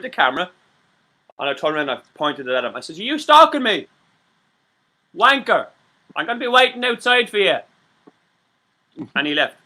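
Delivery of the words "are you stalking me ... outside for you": higher, louder challenge; accusing yell; retold confrontation. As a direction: call him out to his face. Confronting stalker with camera.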